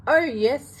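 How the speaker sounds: loud, high, insisting retort; shouted oh yes. Acted. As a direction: fire it back, sure of it. Classmates argue oh no oh yes.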